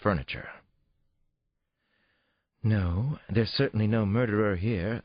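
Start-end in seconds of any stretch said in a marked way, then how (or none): 2.6-5.0 therapist. A narrator's reading. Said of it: "the therapist said".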